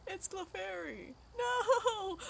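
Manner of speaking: loud, high mock wail; imitating the son's disappointed cry. Mom mimicking her son crying over a Clefairy, loud mock wail. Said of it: "he literally cried".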